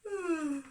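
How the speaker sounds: a high, drawn-out sleepy yawn; sleepy yawning sigh. Acted. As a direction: yawn as Spider waking up. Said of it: "Went Spider".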